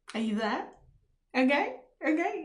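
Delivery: ordinary talk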